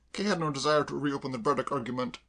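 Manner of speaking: ordinary delivery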